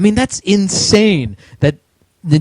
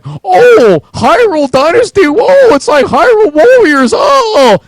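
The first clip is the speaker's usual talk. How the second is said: high, loud gushing fanboy voice; mocking fanboy; 13 semitones higher, 6 dB louder than usual. Ranting at gamers, mocks a fan squealing over Hyrule Warriors. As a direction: ham up a squealing fan who just discovered the game. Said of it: "don't you come to me and act like".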